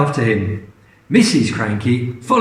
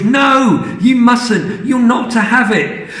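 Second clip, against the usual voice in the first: high, louder no; alarmed refusal; 8 semitones higher, 3 dB louder than usual. Acted. George refusing Grandma the cup, high urgent no.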